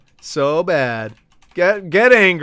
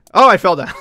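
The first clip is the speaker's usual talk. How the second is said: usual speaking voice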